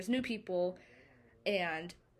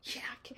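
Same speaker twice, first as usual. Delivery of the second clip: whispered yak; 3 semitones higher, 10 dB quieter than usual. Acted. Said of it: "I just threw up in my mouth".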